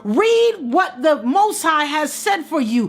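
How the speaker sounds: high, ringing preacher's cry; preaching at full force. Acted. Preacher urging Queen to claim her worth, full force. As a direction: preach it up to the rafters.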